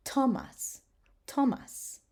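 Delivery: "Thomas" with a plain T, no th; "Thomas" starting like ten; a hard T in "Thomas"; silent H. Shown as a demonstration. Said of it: "just gonna give it the T sound as in ten".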